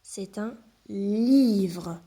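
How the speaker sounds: livre drawn out very slowly; slow modelling for a reading lesson. Shown as a demonstration. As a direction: stretch C'est un livre so the v can be heard. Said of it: "j'entends le son v".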